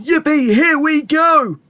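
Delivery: high, drawn-out whooping; exhilarated high-pitched cheer; gleeful shout. A narrator's reading. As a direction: whoop it out, stretching the words. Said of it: "shouted Grandpa Joe again".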